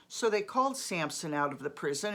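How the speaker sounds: ordinary talk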